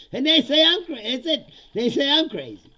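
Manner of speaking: high, loud protest; loud incredulous rant. Real, not acted. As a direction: exclaim it in disbelief. Incredulous rant at being called crazy.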